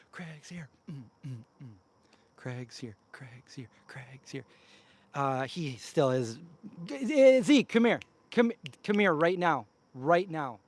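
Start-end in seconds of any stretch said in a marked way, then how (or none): 0.1-4.3 a soft whispered aside. Real, not acted. Whispering to the viewers that Craig has arrived, hushed. Same words whispered right after. 6.8-10.6 loud, higher call across the yard; calling the dog. Real, not acted. Calling the dog Zeke back up the hill, loud and firm. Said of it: "Sorry if that was loud".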